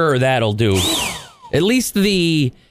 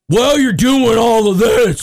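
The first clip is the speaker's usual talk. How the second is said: mock angry rememberer; 9 semitones higher, 4 dB louder, slower than usual. Mock scolding holiday rememberers.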